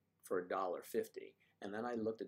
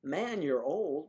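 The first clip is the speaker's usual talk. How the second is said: slow, higher, louder mocking voice; mock insult; 6 semitones higher, 8 dB louder, much slower than usual. Quoting a viewer's rude comment, slow and mocking.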